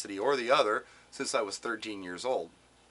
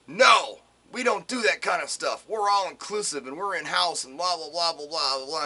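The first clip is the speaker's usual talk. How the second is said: loud, higher, rattling off a brush-off; dismissive brush-off; 4 semitones higher, 5 dB louder than usual. Retold or imitated. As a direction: brush the caller off like a busy agency. Dismissive agency brushing off caller.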